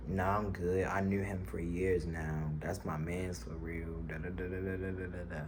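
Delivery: slow, soft, lightly voiced laid-back guy; laid-back friend. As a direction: drawl it, chill and unbothered.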